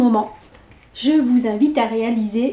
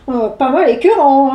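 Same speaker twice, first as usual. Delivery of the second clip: louder, disgusted grandpa voice; grimacing grandfather; 5 dB louder than usual. Acted. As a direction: screw up your face at it. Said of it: "insista son grand-père en grimaçant".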